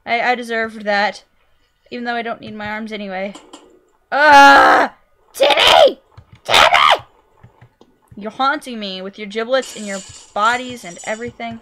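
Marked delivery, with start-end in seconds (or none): 4.1-7.1 real alarmed shout after the son. Real, not acted. Son falls in game.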